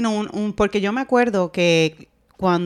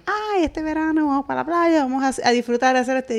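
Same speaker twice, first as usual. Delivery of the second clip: high, breezy voice for the summer plans; mimicking the girls; 6 semitones higher than usual. To the listeners, imitating the sisters dreaming of the beach, higher. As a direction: echo the girls' excited beach talk, pitched up. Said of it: "y Soraya decían".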